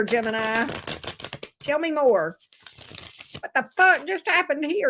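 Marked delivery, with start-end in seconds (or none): 3.4-4.9 faster, lighter indignant quote; quick outraged quote. Acted. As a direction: toss off the outrage briskly. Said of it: "Gemini said".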